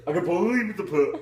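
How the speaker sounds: fast, loud mock-doubtful; hammed-up dubious. Shown as a demonstration. Rereading a line to act it dubiously. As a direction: overplay the doubt. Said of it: "let's say this dubiously".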